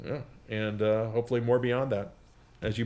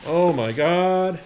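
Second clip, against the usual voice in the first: surprised exclamation; 6 semitones higher, 8 dB louder, much slower than usual. Real, not acted. Opening a birthday gift.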